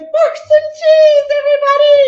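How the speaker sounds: very high, loud, long-held cheer; hyped-up cheer. Pretend. Host hypes Boursin cheese to viewers, shrieking cheer. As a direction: whoop it out and hold the notes.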